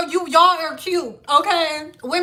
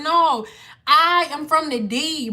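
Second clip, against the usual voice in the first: ordinary talk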